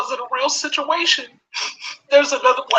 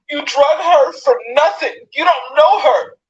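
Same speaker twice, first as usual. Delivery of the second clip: slow, loud and hammering, each word pushed out; furious shout; 2 semitones higher, 3 dB louder than usual. Real, not acted. To the people who mocked her daughter, a slow furious shout. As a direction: make them hear it, slowly and loud.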